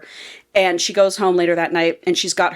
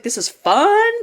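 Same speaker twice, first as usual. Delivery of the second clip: high, rising delight; excited; 8 semitones higher, slower than usual. Retold or imitated. Reader enjoying the book's twist, excited. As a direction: lift it upward with glee. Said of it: "I got really excited".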